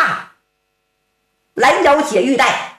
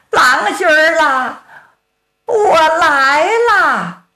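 drawn-out call; 5 semitones higher, slower than usual. Acted. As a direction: stretch it out, calling her husband across the woods. Woman calls out from the pines.

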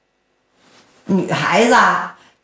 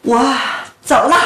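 usual speaking voice

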